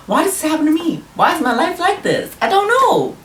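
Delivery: high, dramatic wail about his life; high-pitched why-me whine; exasperated whine. Real, not acted. Exasperated rant about bad luck.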